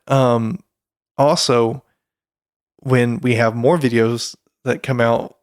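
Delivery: ordinary talk, nothing marked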